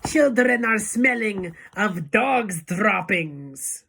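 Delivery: slow, high, loud screech; Grand High Witch screech. A narrator's reading. Witch leader rousing her audience, slow loud screech. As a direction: screech it out, drawing the words out. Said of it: "screeched the Grand High Witch".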